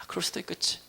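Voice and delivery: hushed, near-whispered concession; accepting. Acted. Preacher voices a believer conceding human failing, hushed and accepting. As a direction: concede it very softly.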